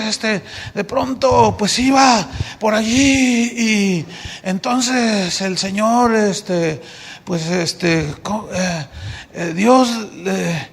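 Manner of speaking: slow, halting, lost words; a faltering beginner who goes blank; stumbling preacher. Retold or imitated. Mocks the stumbling new preacher. (predicador novato titubeante, lento)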